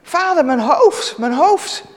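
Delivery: high, loud, slow cry; boy in the field cries out to his father; crying out in pain. Acted. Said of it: "En opeens riep hij".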